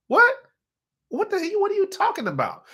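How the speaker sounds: high-pitched mock bafflement; incredulous. Citizen answering the government's offer, incredulous. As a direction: squeal it in disbelief.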